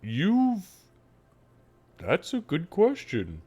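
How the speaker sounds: slow, swooping cow voice with long pauses; halting, lowing cow speech; pondering talking cow. Acted. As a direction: mull it over like a thoughtful cow. Game master plays the talking cow.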